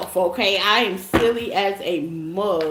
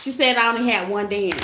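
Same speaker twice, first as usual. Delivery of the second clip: ordinary delivery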